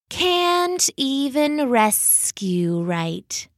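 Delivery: slow, drawn-out snake voice; snide adder dragging the words; sneering adder. Acted. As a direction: let the snake sneer it out slowly.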